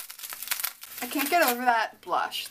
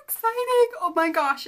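thrilled, pitched way up; excited; 9 semitones higher, 5 dB louder than usual. Real, not acted. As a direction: squeal with delight at a present. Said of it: "this is so exciting".

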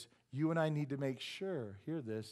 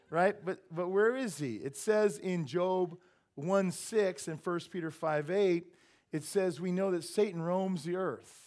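ordinary talk, nothing marked

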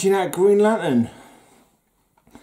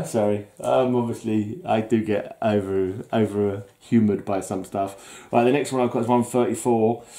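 ordinary talk, nothing marked

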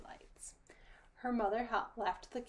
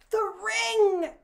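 much higher, much louder outcry; panicked scream; 13 semitones higher, 15 dB louder than usual. A narrator's reading. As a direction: scream it out in sudden shock. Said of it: "she screamed".

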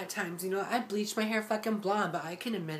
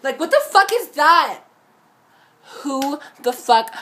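loud, higher-pitched angry yell; furious outburst; 6 semitones higher, 12 dB louder than usual. Real, not acted. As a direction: explode at two-faced people. Angry rant about fake friends.

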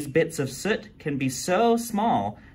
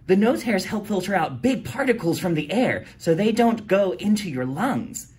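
know-it-all explaining; 7 semitones higher, 4 dB louder than usual. Acted. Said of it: "D A tells us".

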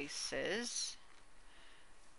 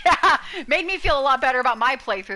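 loud laughing yeah; 5 semitones higher, 10 dB louder than usual. Real, not acted. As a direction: laugh through the words. Laughing agreement.

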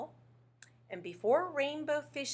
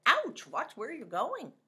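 grumpy pufferfish; 5 semitones higher, 4 dB louder than usual. Retold or imitated. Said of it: "He said".

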